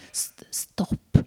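unvoiced urgent whisper; whispered stop. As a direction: warn softly so the snake stays calm. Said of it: "ska du säga så här". (viskat stopp)

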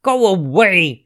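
loud, high, cross dismissal; witch's shout. A narrator's reading. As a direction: shout it as the witch shooing off a pest. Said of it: "shouted the witch".

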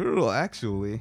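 a lightly voiced know-it-all nerd. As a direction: correct them like a pedantic nerd. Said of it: "make a nerd voice just for comedic effect".

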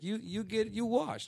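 put-on high, halting Italian-accented English; Italian barber. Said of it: "I got my hair cut in Italy".

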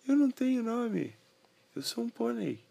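high little pony voice; squeaky pony introducing itself; talking pony. Acted.

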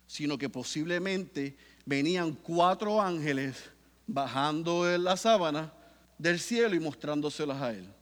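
ordinary talk, nothing marked

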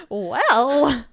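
ordinary talk, nothing marked

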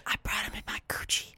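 hushed, breath-only confession; imitated whisper. Cellmate confiding in an unwilling listener, imitated whisper. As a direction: play a cellmate whispering a smuggling secret. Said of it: "thinks she's whispering".

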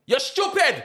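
high shouted insult; mock shout.

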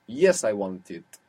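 slow "Yes, I want it" with weight on "Yes"; emphatic yes. Shown as a demonstration. Teacher translates the Spanish example, emphatic "Yes" in "Yes, I want it". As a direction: hit "Yes" firmly, then finish "I want it". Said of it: "you use yes emphatically".

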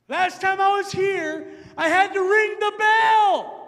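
loud, slow, commanding proclamation; loud commanding woman announcing her mission; with authority. Retold or imitated. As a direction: declare it like a prophet with full power. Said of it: "she says it with an authority".

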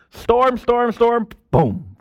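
rising loud storm, storm, then a punched boom; building storm to a sudden boom; excited storm build-up. Pretend. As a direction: act out the storm crashing. Acting out the storm.

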